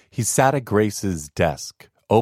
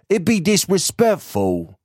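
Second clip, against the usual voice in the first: higher, country workman agreeing; a hint of a rustic laborer; rustic gravedigger; 11 semitones higher than usual. Second gravedigger.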